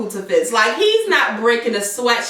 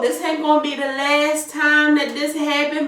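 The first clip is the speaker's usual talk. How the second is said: ordinary delivery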